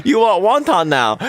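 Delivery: high, loud put-on Chinese accent, sneering. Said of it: "a piece of shit racist accent".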